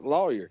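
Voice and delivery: "Lawyer" said the Southern way, falling; Southern pronunciation. Shown as a demonstration. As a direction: demonstrate "Lawyer" with a Southern first syllable. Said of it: "that's how you say it".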